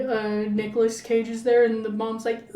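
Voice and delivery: ordinary talk, nothing marked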